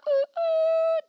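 high-pitched excited ooh; excited squeal. Real, not acted. Squealing at a listener's letter. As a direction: squeal with delight at the name.

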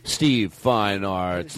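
low, very slow grand name; fancy name-saying. Shown as a demonstration. Host addressing the director, fancy and stretched. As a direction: roll the name out low and stately. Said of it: "said his name as fancy as we could".

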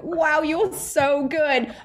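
high, drawn-out starstruck wow; acted-out wide-eyed awe; awestruck. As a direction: gush slowly, lost in admiration. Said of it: "I'm in complete awe of them".

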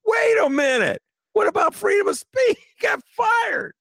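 very high, incredulous cry; outraged protest. Real, not acted. Guest objecting to Musk firing critics, high outraged protest. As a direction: pitch it way up in indignation.

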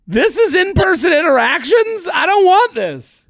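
hammed-up horror at dating in person; mock dismay. His dismayed reaction retold.

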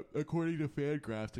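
talking through a yawn; words stretched by a yawn. Real, not acted. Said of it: "excuse me, sorry for the yawn".

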